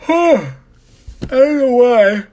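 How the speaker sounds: words stretched through a real yawn. Real, not acted. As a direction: talk mid-yawn, pitch jumping high. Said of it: "I'm yawning so much".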